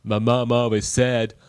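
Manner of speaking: low-pitched American film simpleton impression; Tom Hanks as Forrest Gump. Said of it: "Why does he sound like Tom Hanks in Forrest Gump?".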